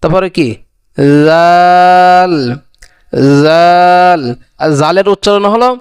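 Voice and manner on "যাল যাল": জাল as a long "dhaal", tongue on upper teeth tips; tongue-tip Arabic zal. Shown as a demonstration. Teacher demonstrates জাল with tongue at upper teeth, buzzed and prolonged. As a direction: buzz the start of জাল and hold it level. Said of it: "জিহ্বার আগা সামনের উপরে".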